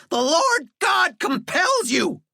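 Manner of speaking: high, slow shrieking. Acted. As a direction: shriek like an exorcist. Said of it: "Walter shrieked".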